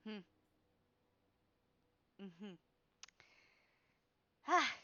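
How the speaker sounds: ordinary talk, nothing marked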